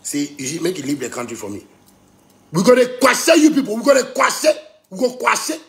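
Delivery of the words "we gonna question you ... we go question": loud threat. Acted. Threatening the presenters.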